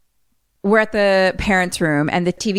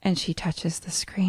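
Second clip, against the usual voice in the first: soft unvoiced whisper; hushed; 7 dB quieter than usual. Real, not acted. Telling the scary part to a friend in a whisper. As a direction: whisper it for suspense. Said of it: "hushed tense narration".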